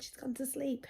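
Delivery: whispered, high. Retold or imitated. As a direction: whisper it to the older daughter. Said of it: "I said".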